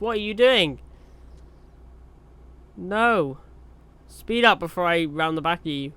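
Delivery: half-joking annoyance at the driver in front. Real, not acted. Yelling at slow truck ahead.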